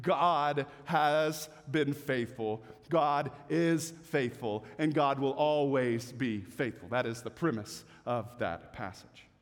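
slow, low, earnest affirmation; solemn declaration. Real, not acted. Preacher affirming God's faithfulness, slow and low.

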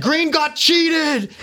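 put-on high, very slow, slurred drunken call; slow high drunk voice to the king; drunk plea. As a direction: drag each word out like you've had too much. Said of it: "I'm smashed".

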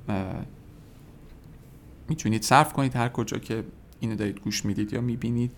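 ordinary talk, nothing marked

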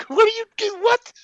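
very high, baffled squeak; mock bewilderment. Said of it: "You're like".

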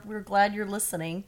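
normal talking voice

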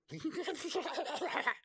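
high bubbling laugh; gurgling, gleeful demon cackle. A narrator's reading. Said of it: "queer, bubbling laugh".

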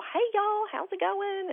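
put-on high-pitched folksy Southern accent; Gomer Pyle drawl. Mom mimicking the podiatrist's greeting, high Southern accent. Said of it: "Gomer Pyle".

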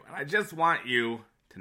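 ordinary delivery

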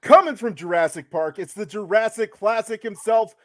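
stretched ring announcer call; grand entrance announcement. Acted. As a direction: drag out each other like a boxing MC. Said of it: "That was the best intro ever".

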